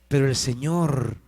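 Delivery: ordinary talk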